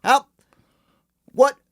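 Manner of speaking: Boston weed-shop clerk greeting; Boston accent. Pretend. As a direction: shout a hammed-up Boston welcome. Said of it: "That's me trying to imitate the accent".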